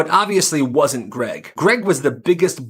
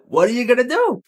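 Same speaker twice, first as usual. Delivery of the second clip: mock shrug again; 10 semitones higher than usual. Joking shrug repeated.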